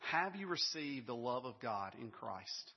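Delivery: slow and deliberate, each word set down; slow, weighty question. Acted. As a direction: ask it slowly so it lands. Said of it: "first question I want to ask you".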